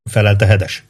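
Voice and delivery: ordinary delivery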